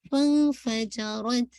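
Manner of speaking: slow फ़- then फजरत linked, with no hamza; teacher's slow demo of फजरत; shown reading. Shown as a demonstration. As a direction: say फजरत slowly, joining फ़ straight onto it. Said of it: "फ़ा की वजह से हमज़ा ने".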